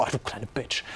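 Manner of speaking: a quiet, muttered mock insult under the breath. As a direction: mutter it half to yourself. Imagined insult muttered at woman. (leise gemurmelte Beleidigung)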